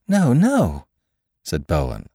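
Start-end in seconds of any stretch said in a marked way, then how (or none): 0.1-0.9 high, loud, wild protest; wild fatigue. A narrator's reading. Curate rejecting the doctor's suspicion, wildly fatigued. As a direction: wave it away in weary desperation. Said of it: "with a sort of wild fatigue".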